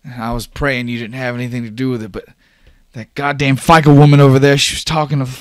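high-pitched, building to loud exasperation; worked-up young man, voice climbing high; worried, agitated Oscar. Acted. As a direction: demand answers, increasingly upset. Plays Oscar confronting Dash.

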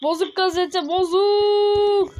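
high, loud cry of alarm; alarmed outburst. Real, not acted. Gamer finding the newspaper broken, loud alarmed cry. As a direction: yelp it out as things break.

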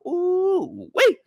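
high, excited ooh; mock giddy high voice; excited young boy. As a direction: squeal like a teen at the club. Young boy at strip club.

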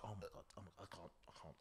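very hushed muttering to himself; low, quiet re-enacted dread; horrified whisper. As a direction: whisper as if afraid to look. Muttering while avoiding watching the beating.

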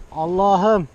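loud, high cry of suspense; a real gasp of nerves turned into a shout; tense outcry. Real, not acted. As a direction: blurt it out as the drone climbs. Drone climbing, tense exclamation.

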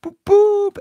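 very high, very loud train toot; piercing high hooting; screamed boop. Pretend. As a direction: shriek it like a train whistle. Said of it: "I'm screaming boop".

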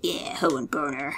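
soft unvoiced whisper; hushed, low whispered aside; whispered joke. Real, not acted. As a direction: slip the nickname in under the breath. Muttered aside.

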